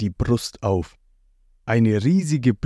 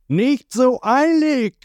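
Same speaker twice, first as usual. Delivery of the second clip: high, louder, drawn-out call; called from the woods; 13 semitones higher, 4 dB louder, slower than usual. A narrator's reading. An enemy calls out from the forest, high slow shout. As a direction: stop them from afar. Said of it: "schreit jemand aus dem Wald".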